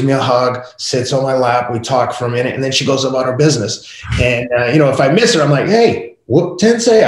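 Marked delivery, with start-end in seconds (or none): 5.7-7.1 high calling-out yell across the house; dad calling out to his daughter, a high call. Retold or imitated. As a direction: holler her name from the next room. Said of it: "I yell out there to her".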